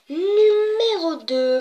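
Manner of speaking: high, loud, long-stretched number call; countdown announcement. Acted. Host announces number two of the list, showy announcement. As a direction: draw it out as a grand reveal.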